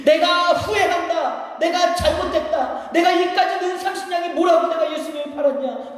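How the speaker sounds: high, drawn-out cry of remorse; anguished regret. Acted. Judas regretting selling Jesus, anguished high cry. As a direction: wail the confession in a high, slow preacher's voice.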